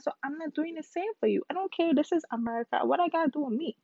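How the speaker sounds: defiant rant, pitched high; strongly opinionated. Real, not acted. Caribbean speaker refusing to explain for Americans, defiant. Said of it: "I feel very strongly about that".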